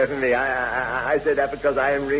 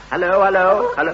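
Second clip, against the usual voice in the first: loud, raised, repeated hello; calling down phone; 6 semitones higher, 7 dB louder than usual. Acted. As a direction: try to raise someone on a bad line. Calling into a telephone line.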